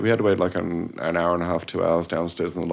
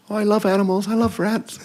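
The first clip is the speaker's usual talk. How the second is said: mimicking a pop star's tiny high voice; 4 dB louder than usual. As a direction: squeak it up high and breathy. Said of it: "really, really high-pitched, squeaky voice".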